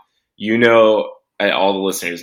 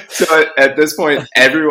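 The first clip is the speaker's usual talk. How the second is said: laughing; 9 semitones higher, 7 dB louder than usual. Real, not acted. Laughing while retelling the story.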